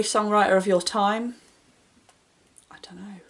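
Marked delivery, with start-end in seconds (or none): 2.7-3.2 very soft, whispered admission; stumped whisper. Real, not acted. Stumped by a question about songwriters, whispering. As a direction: barely whisper it, at a loss.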